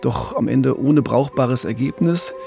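normal talking voice

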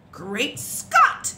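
loud, staggered exclamation; astonished storybook duke; shouting. A narrator's reading. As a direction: burst out in astonishment. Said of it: "he shouted".

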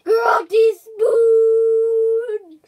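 drawn-out desperate yell; frantic shout. Real, not acted. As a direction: yell it slowly, stretched and desperate.

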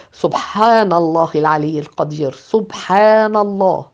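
usual speaking voice

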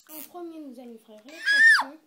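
loud classroom announcement; shouting teacher. Acted. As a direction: bellow it to the whole class. Teacher announces lesson loudly.

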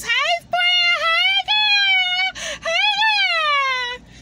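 put-on high, loud squealing hey girl; squealy girlfriend greeting. Greeting new subscribers in a squealing girlfriend voice, high and loud. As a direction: greet them like a gushing best friend. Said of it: "I wanna say to all my new subscribers".

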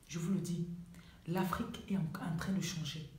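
soft, low, slowing to a near whisper; solemn promise fading into a whisper; hushed assurance. Real, not acted. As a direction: assure them quietly, letting the voice sink. Solemn promise repeated in a hush.

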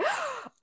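sharp high gasp of recognition; quick delighted intake of breath; retold gasp. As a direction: gasp like you just placed a face. Friend recognizing her, retold.

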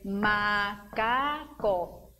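"Macaco" slowly, in three separate beats; syllable by syllable. Shown as a demonstration. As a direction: beat out "Macaco" as ma, ca, co. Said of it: "você pode bater com o pé".

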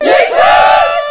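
very high, very loud calling shout. Acted. Sick men shout for Jesus, very high and loud. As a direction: yell the name across the road. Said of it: "Ze riepen Jezus".

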